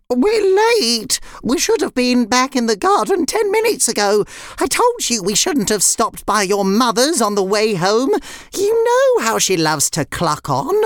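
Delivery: high, agitated hen voice; fretting chicken. Acted. Nuala scolds Red Ribbon for being late, fretting. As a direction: fuss and scold in a high character voice.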